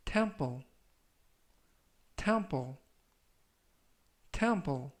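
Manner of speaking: "temple" three times, long pause after each; slow model reading. Shown as a demonstration. Teacher reviews vocabulary for followers, slow model reading. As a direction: read out "temple" slowly for learners to repeat. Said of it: "천천히 세 번씩 읽어드리고요".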